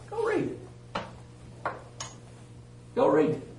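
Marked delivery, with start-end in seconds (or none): 0.1-0.6 emphatic challenge. Real, not acted. Preacher challenging hearers to check scripture.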